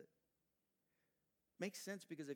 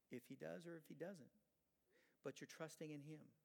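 normal talking voice

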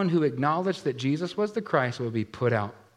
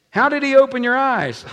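demanding; 11 semitones higher, 11 dB louder than usual. Acted. Interrogators pressing the healed man.

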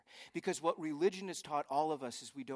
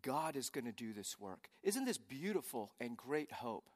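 ordinary delivery

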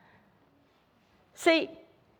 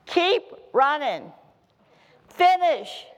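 mock coach shout; coach yelling; 2 semitones higher, slower than usual. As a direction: coach barking at a runner. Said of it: "the coach would always yell".

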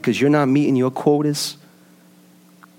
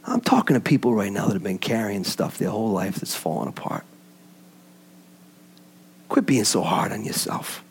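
tender consoling; 4 semitones lower, 4 dB quieter than usual. Acted. Pastor gently comforting the hurting in the pews. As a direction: lower and softer, comforting the hurting.

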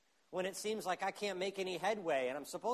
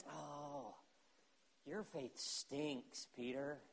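soft, very slow sneering jab; a hint of a contemptuous scold; mock scolding; 2 semitones lower, 8 dB quieter, much slower than usual. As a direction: drag out the put-down quietly. Mock scolding Jesus he rejects.

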